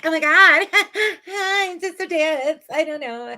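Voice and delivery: put-on high laughing valley-girl voice; giggly airhead imitation; mocking Kathy. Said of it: "her being like".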